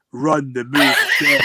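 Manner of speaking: loud, laughing insistence. Retold or imitated. Player insisting teammate repeat the move, laughing. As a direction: push the call again through a laugh.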